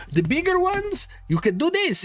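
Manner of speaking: high, broken-English shopkeeper voice; eccentric toymaker. Acted. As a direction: greet the customer with a high, odd accent. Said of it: "He says".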